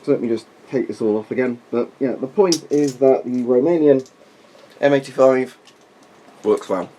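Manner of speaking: muffled behind a rubber mask; through a gas mask. Real, not acted. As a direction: talk through the mask's voice diaphragm. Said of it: "voice diaphragm hopefully looks quite good".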